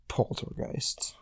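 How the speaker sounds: whispered slowly, breathy and drawn out; mock-scared whisper of Poltergeists; spooky whisper. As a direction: hush it like a ghost story. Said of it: "and then you're like".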